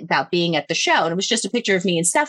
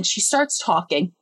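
ordinary talk

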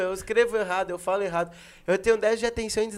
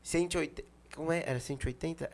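mock dad questioning; 5 semitones lower, 11 dB quieter than usual.

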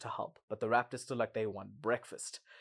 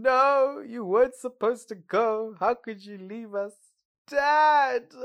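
much higher, louder hammed-up wail; mock crying scream; 10 semitones higher, 9 dB louder, slower than usual. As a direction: wail it like overdramatic kids losing their dad. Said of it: "busy crying, screaming".

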